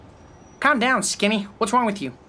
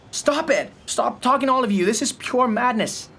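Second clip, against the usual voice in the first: 1 exasperated shout; 7 semitones higher than usual. Acted. William shouts down his voices.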